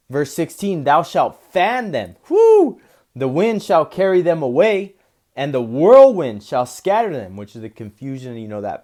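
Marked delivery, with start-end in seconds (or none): 0.8-2.2 slow, solemn reading, louder at the start; scripture reading. Acted. Preacher reading the Lord's promise, slow and proclaiming. Reads verse sixteen. 2.3-2.8 very high, loud whoo; excited whoop. Real, not acted. Preacher whooping at the verse about the wind, excited. 3.1-7.4 louder, stirred-up reading; excited scripture reading. Acted. Preacher carried away by the verse, loud and stirred.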